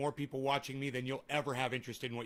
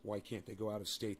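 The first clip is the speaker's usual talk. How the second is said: bitter muttered quote; 9 semitones lower, 12 dB quieter than usual. Retold or imitated. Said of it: "really fucking pissed me off".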